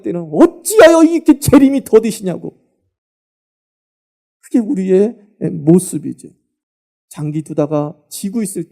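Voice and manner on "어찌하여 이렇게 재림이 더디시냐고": higher, louder mock grumble to God; mock impatient lament; whining complaint. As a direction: whine about the delay. Complaining prayer mocked.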